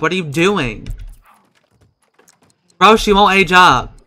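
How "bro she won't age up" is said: loud, raised exasperated complaint; frustrated outburst. Real, not acted. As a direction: complain loudly at the stuck game. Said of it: "I'm so mad right now".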